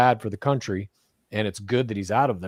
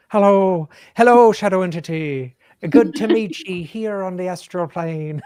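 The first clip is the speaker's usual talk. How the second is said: higher, louder hammed-up Irish accent; 8 semitones higher, 6 dB louder than usual. Greeting a shadow entity in a hammed-up Irish accent. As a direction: greet the spirit in a big stage Irish. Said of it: "with your Irish accent".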